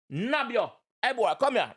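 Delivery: ordinary talk, nothing marked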